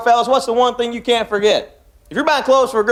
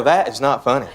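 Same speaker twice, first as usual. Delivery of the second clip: much lower, flat-voiced women scoffing; ladies' deadpan reply; 9 semitones lower than usual. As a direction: drop low and unimpressed. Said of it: "all the ladies go".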